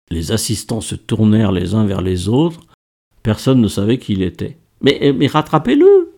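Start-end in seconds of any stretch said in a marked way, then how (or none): 4.8-6.1 high, urgent stammered order; raised, pressing voice; urgent call. A narrator's reading. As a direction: call out for someone to chase him. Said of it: "lança Laure".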